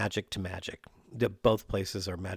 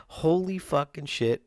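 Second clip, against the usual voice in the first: excited outburst; 6 semitones higher, 5 dB louder than usual. Real, not acted. Urging listeners to back up.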